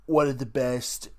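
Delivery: badly faked politician voice, emphatic pauses; Donald Trump. Podcaster defending the bad impression, still doing a mock Trump. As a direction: boast in short emphatic chunks as a mock president. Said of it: "try to do a Donald Trump impression".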